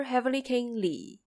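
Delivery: normal talking voice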